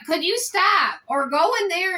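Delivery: high exasperated plea; fed up, demand it stops; irritated. Real, not acted. Annoyed at family member's noises.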